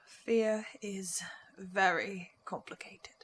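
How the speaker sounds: whispered, slow and low; hushed echo. Acted. Echoing Astrid's words in a slow whisper. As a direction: breathe the words back to her. Echoing her line in a hush.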